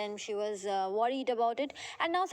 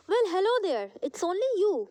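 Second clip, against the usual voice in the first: Papa's greeting; 6 semitones higher, 5 dB louder than usual. Acted. Papa greets Lomov in reading.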